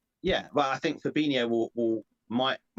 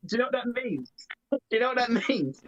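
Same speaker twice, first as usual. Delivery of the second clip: teasing; 7 semitones higher than usual. Pretend. Teasing question repeated.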